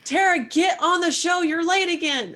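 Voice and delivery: high, loud mock scolding; playful yelling. Co-host summoned through the smart speaker, loud mock yell. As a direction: holler it like a nagging message through a speaker. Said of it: "I can just kinda yell at you".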